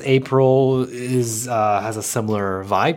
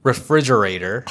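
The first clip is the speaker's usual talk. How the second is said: refrigerator with full American r sounds; American accent. Shown as a demonstration. Showing his co-host the authentic word, American accent. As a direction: model "refrigerator" in American English, r-colored ending. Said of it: "can you say it?".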